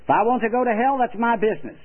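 put-on a stubborn old man brushing the preacher off; old man refusing the preacher, gruff and defiant. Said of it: "in a rather gruff voice".

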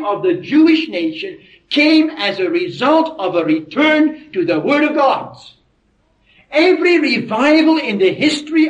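high-pitched, insistent sermon cadence; emphatic preaching. Real, not acted. Preacher tracing revivals in church history, emphatic preaching. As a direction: punch out the list like a preacher.

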